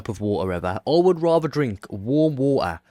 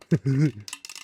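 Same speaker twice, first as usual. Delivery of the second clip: short laugh; 3 semitones higher than usual. Real, not acted. Laughing at three litres.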